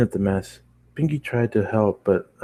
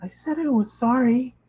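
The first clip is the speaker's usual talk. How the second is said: timidly. Acted. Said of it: "she said timidly".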